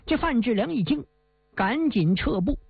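plain reading voice

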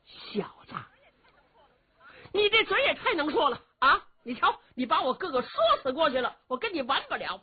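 angry scolding. Acted. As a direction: tear into the young man, pitch up. 东方伯 scolds and hits 罗成.

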